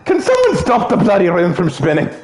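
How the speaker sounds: tipsy, hammed-up Irish accent; drunk Irish accent. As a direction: play a dizzy Irish drunk. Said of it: "if I wanted to sound drunk".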